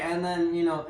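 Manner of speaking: ordinary delivery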